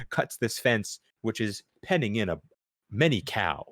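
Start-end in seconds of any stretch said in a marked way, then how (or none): none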